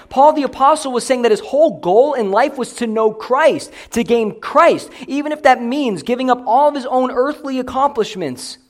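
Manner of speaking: high, loud, insistent sermon delivery; full-force preaching. Real, not acted. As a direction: press the argument home to the congregation.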